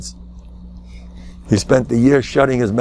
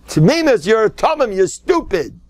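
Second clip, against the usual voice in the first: raised quoting; 10 semitones higher than usual. Retold or imitated. Quoting Reb Gershon's shmooze.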